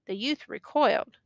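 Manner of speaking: ordinary delivery, nothing marked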